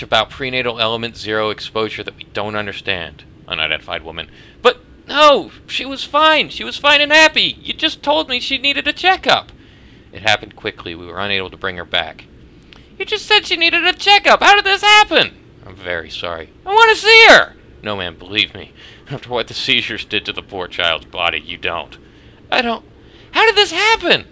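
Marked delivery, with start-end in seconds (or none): 4.6-9.5 high, desperate pleading grief; distraught mother. Acted. Grieving mother confronts doctor. 13.0-15.4 high, loud cry of disbelief; anguished mother. Acted. Mother demanding answers. 16.6-17.6 high, loud desperate plea; anguished demand. Acted. Mother demanding to see baby. 22.5-24.3 high, loud cry of disbelief; anguished mother. Acted. Mother breaking down.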